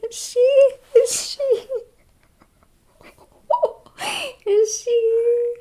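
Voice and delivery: squeaky-high question through laughter; laughing mockery. Mocking the supposed rival, high and laughing.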